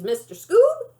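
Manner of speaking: high teasing lift on Scoob; grandma voice. A narrator's reading. Jima teasing formally.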